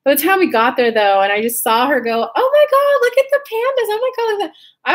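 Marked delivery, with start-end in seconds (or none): 2.3-4.9 imitating a friend overjoyed by the pandas; thrilled squeal. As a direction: squeal like a kid at the zoo.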